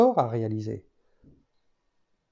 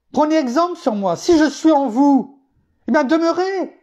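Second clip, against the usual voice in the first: pitched high and pressing, plea to abide; preacher exhorting the congregation, high and insistent; fervent preaching; 10 semitones higher, 3 dB louder than usual. Acted. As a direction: urge the flock, voice climbing. Preaching at full force.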